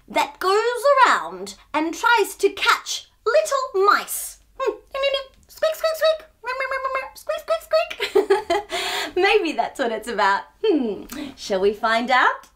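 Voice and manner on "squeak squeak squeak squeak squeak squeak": mouse squeaks. Pretend.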